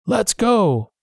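a terrified pirate begs the crew to run; frightened urging. A narrator's reading. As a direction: plead to flee at once. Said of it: "one of the pirates gasped".

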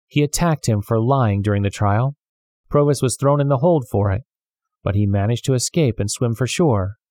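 ordinary delivery

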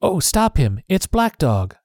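high urgent cry to stop him; boy calls for the pirate to be stopped, alarmed cry; cried out. A narrator's reading. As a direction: point and call out in alarm. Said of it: "I cried".

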